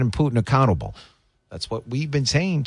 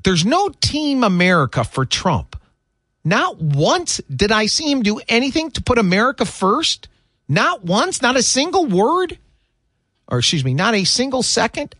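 raised pitch, scornful reading of the quote; climbing higher on each not once, mocking her; 7 semitones higher than usual. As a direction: read her words back as if they're absurd.

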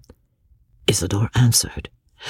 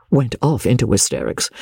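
plain reading voice

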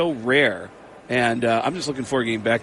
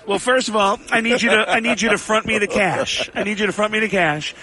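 light Kinison voice, pitched higher; Sam Kinison impression; 6 semitones higher than usual. Prank caller as Kinison asking Tom Arnold for cash, light put-on. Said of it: "And I said".

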